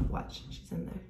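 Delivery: hushed whisper so mom won't hear. Real, not acted. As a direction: whisper it, she's right outside. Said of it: "my mom's gonna come into the bathroom".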